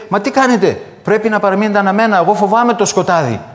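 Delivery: put-on high, fast, loud scared little child; a child's frightened cry for the candles to stay lit. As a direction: plead like a small child afraid of the dark. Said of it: "φοβισμένο από το μισοσκόταδο".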